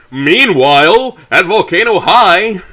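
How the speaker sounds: high, loud, slow cartoon narrator voice; booming, slow Meanwhile announcer voice; dramatic announcer. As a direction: announce the scene change like a TV show. Dramatic scene change announcement.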